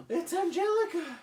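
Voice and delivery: put-on high, excited baby shriek; Tommy the baby. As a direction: squeal it like a shocked toddler. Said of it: "Tommy goes".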